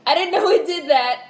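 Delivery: surprised delight. Real, not acted. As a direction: camera zooms in unexpectedly, she's amazed and loud. Surprised by camera zoom.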